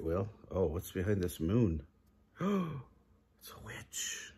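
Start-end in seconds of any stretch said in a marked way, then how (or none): 2.2-4.3 gasp, then a slow, soft whisper; hushed whisper. Acted. Reader whispers the witch secret to the kids. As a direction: whisper it so Pete won't hear.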